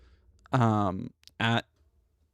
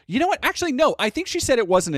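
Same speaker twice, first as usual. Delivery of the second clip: much higher, louder sudden reversal; sudden change of mind; 11 semitones higher, 5 dB louder, faster than usual. Real, not acted. Correcting a chat answer, suddenly much higher. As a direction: catch yourself mid-thought and flip.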